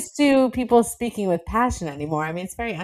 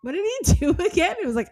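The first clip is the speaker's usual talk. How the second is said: ordinary talk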